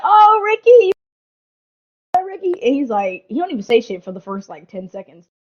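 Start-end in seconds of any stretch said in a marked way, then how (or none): 0.0-2.6 high, loud sing-song "oh Ricky", fading on the last name; mock sympathy. Imitating everyone cooing at Ricky on the ground. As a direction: coo over him like everyone did. Crowd mocking fallen friend.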